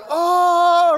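high, loud startled gasp of an oh. Retold or imitated. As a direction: jump at the sudden blast of noise. Deafening music startles everyone.

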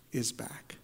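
whispered, weighty reveal; breath-only, reverent; hushed awe. Real, not acted. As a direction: drop to a whisper for wonder. Hushed climax of resurrection line.

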